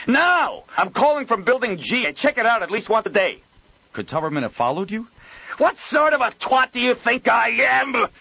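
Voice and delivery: high, loud outraged ranting; furious yelling. Acted. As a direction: rage down the phone. Joel furious on phone.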